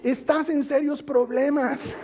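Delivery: father joking to Jesus about the lost boot, mock worried; mock despair. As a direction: sigh it out in playful alarm. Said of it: "yo digo".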